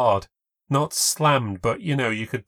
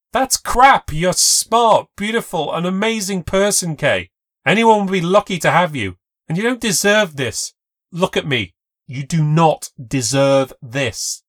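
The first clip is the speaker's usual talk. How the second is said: loud, high, insistent pep talk; fierce encouragement; 7 semitones higher, 7 dB louder than usual. A narrator's reading. Narrator urging Kayla she deserves better, loud and insistent. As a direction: shake her into believing it.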